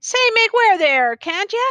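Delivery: high, loud shout to clear the way; yelling. A narrator's reading. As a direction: bark at the crowd to move. Officer yells at blocking crowd.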